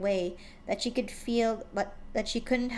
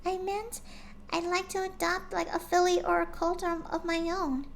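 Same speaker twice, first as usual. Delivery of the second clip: gentle shy pony; 9 semitones higher than usual. A narrator's reading. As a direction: speak like a shy gentle mare. Fluttershy voiced in story.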